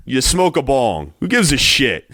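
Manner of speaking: ordinary delivery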